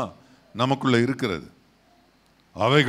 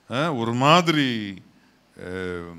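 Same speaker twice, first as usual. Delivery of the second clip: ordinary talk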